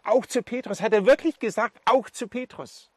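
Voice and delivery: high, loud, incredulous excitement; dawning hope. Acted. Peter repeats the message meant for him, amazed and hopeful. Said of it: "Wie so ein erster Hoffnungsschimmer".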